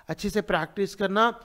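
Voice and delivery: ordinary talk, nothing marked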